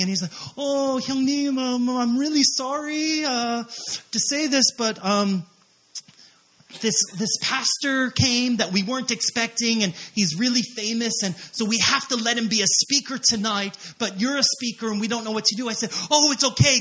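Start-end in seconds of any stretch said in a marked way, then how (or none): 0.6-15.7 high-pitched, hesitant apology; imitated embarrassed organizer, stretching the fillers; apologetic friend. Apologetic friend on phone, acted. 16.1-16.8 high, loud, breezy brush-off; acted delighted let-off; cheerful reassurance. Reassuring the apologetic friend, acted.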